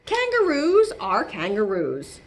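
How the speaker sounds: plain reading voice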